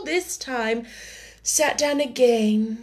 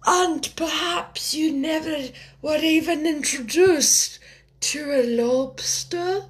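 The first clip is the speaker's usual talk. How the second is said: mournful Mock Turtle; 7 semitones higher than usual. A narrator's reading.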